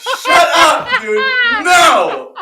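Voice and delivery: high shrieking shout of disbelief; incredulous shout. Real, not acted. Yelling at a friend over a sandwich, outraged shout. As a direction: yell it in mock outrage at a friend.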